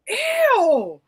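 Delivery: high, loud drawn-out recoil; sliding squeal of revulsion; disgusted. Retold or imitated. Woman retelling kissing a cigarette smoker, disgusted.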